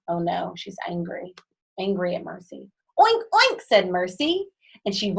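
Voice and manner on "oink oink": very high, loud squealing oinks; playful pig oinks. A narrator's reading. Mercy teasing Eugenia with high loud oinks.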